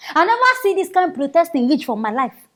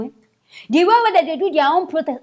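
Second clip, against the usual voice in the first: ordinary talk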